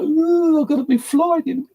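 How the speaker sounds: high, floaty ooh, dazed and giddy; stoned high voice. Retold or imitated. Retelling to a listener how the weed smoke hit him, in a high giddy stoned voice. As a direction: sound like the weed smoke has gone to your head.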